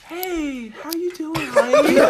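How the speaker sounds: softest sweet talker voice. Retold or imitated. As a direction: coo it like sweet talk. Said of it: "I use the softest voice possible".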